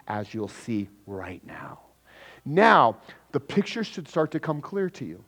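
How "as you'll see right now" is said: soft hushed aside, slow; whisper. Acted. Preacher teasing the congregation, in a slow whisper. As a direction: lean in and whisper the tease.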